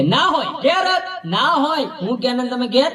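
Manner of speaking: high comic disbelief; imitated incredulous wife. Wife phoning husband from her parents' house, high mock disbelief. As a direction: question it high, mock suspicious. Suspicious wife on phone, acted.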